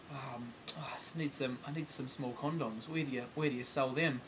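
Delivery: breathy unvoiced whisper, hushed and secretive; embarrassed whisper. A shy customer whispering to a shop assistant, hushed whisper.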